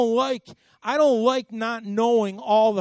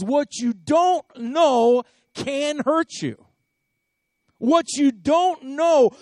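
louder, higher sermon push, lingering on the warning; preaching at full force; 4 semitones higher, 5 dB louder than usual. Acted. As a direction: drive the point home to the congregation. Preaching climax on surprises.

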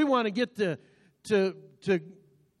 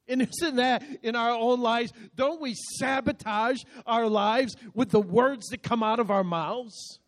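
normal talking voice

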